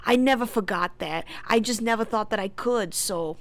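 loud, earnest put-on voice; Steve. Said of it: "And Steve says".

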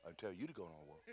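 very soft, low-pitched comeback; low deadpan retort. Retold or imitated. God correcting the preacher, low and quiet. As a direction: answer back quietly, dropping right down. God's deadpan answer in retold dialogue.

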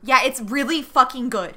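emphatic enthusiasm. Real, not acted. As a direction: insist on it at full volume. Bursts in defending a childhood favorite.

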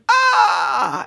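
long, high imitated scream; drawn-out high "ah" of a panicking crowd; imitating screaming people, not full force; mock scream. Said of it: "And they're screaming".

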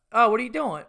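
imitating the owner calling out; club owner's greeting. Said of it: "goes, uh".

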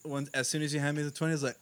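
ordinary talk

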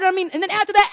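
ordinary talk

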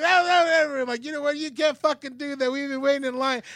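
high-pitched squabbling protest; mock joint outrage. Two shoppers united against the manager, high mock outrage. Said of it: "We both were like".

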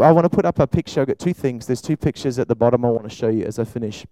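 ordinary talk

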